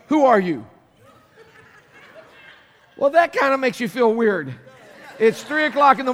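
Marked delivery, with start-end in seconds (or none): 0.1-0.7 startled wife's question. Retold or imitated. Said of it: "and Cindy goes".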